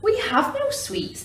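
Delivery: high, small ant voices; timid tiny creatures answering; little ants. Acted.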